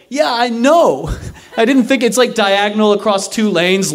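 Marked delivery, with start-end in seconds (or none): 0.1-1.1 high-pitched exasperated comeback. Retold or imitated. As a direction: act fed up at being told the obvious. Exasperated answer to cops.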